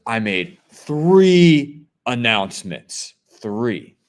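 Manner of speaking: slow, loud mock outrage, hammering THREE; exasperated scolding with the count stretched out, imitated; imitated angry yelling. Lady scolding a shopper over ignored announcements, mock yelling. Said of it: "screamed at me".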